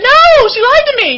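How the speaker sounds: high, loud insistence; indignant protest. Retold or imitated. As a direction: cry it out, sure you were wronged. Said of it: "I said".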